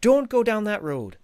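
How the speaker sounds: put-on high, loud, hammy Irish accent; bad Irish accent. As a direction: play a chatty Irish local giving directions, Irish accent. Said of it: "it's a terrible Irish accent".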